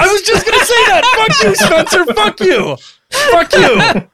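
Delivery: high-pitched, laughing playful cursing; laughing mock outrage. Host cursing friend who stole the joke, laughing mock outrage. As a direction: squeal in mock indignation through laughter.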